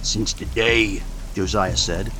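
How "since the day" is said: put-on grim old farmer. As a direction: say it flat and heavy like a dead man. Josiah's character voice.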